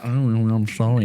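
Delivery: slow, drawn-out put-on sorry; mock meek apology. Co-host teasing him about the train, mock apologetic. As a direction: tease him with a dragged-out apology.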